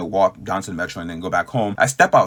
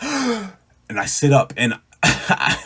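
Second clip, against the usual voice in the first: sharp gasp; 6 semitones higher, much slower than usual. Retold or imitated. Reliving waking with deep breath.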